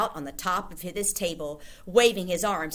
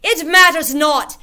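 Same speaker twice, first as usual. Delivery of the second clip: high, very loud witch shout; 10 semitones higher, 14 dB louder than usual. A narrator's reading. Grand High Witch shouting to the audience. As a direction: dismiss it with a shout. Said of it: "shouted the Grand High Witch".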